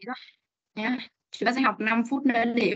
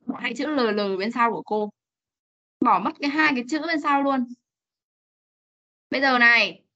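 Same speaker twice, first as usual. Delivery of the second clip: normal talking voice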